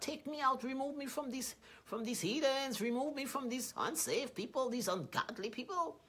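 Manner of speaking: lightly hammed-up complaint to God; whiny plea to God. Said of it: "Don't go crying to God".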